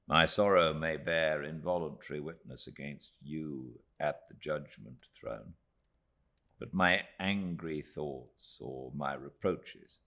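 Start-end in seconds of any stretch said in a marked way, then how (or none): none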